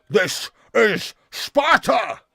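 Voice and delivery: slow, high, loud movie-hero shout; Spartan war cry. Acted. Host quoting the film after claiming to be quarter Spartan, war cry. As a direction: bellow it word by word like a battle cry. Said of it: "why he's speaking in a Scottish accent in Sparta".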